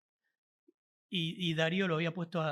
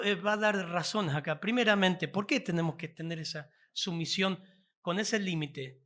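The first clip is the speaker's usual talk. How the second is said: normal talking voice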